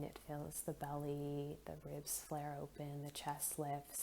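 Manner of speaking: soft, unhurried meditation voice with long pauses; guided meditation. Acted. Leads a guided breathing meditation.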